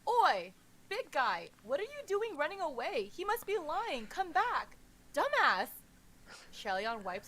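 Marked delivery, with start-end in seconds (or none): 0.1-5.7 loud, high yelling after him; furious yelling after a fleeing giant; shouting. Acted. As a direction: bellow at someone running off. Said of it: "Ming Guang shouts at him".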